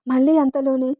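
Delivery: plain reading voice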